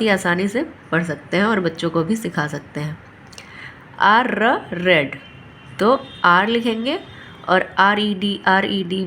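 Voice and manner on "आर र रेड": "आर", "र", "रेड" said apart, slow and deliberate; slow phonics sounding out. Shown as a demonstration. Teacher breaking a word into sounds for parents, slowly. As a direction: stretch each sound of "आर र रेड" before blending. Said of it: "phonic का sound करके break करके पढ़ेंगे".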